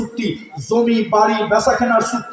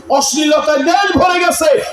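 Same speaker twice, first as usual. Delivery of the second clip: higher, shouted second time; shouted repeat; 6 semitones higher than usual. Real, not acted. Preacher to the crowd, repeated with a higher shout. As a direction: hammer the line home with a shout. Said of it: "আজকে অশ্লীলতায় দেশ ভরে গেছে".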